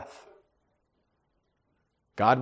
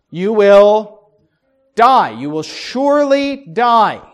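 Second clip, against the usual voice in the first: booming warning; 3 semitones higher, 8 dB louder, slower than usual. Acted. God's warning quoted in sermon.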